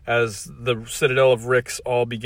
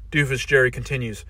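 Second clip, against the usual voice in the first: ordinary delivery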